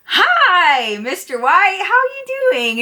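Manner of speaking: high, sing-song chirpy hello; chirpy greeting. Retold or imitated. Waitress greeting her regular at the bar, high and chirpy. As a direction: greet a favourite customer in a high, gushing lilt.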